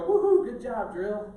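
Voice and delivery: loud, high mock woohoo; mock cheering. Pastor mocks praising his own drill, a loud high cheer. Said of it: "I don't sit there and go".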